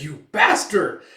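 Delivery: furious insult. Acted. Guard raging at Guts who spat on him, furious. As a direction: curse him with full rage.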